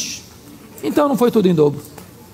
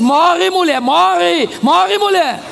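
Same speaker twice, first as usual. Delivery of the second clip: high, hammed-up taunting cry; taunting payback; 8 semitones higher, much slower than usual. Job throwing the wife's words back at her, taunting. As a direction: throw it back in a raised, mocking voice.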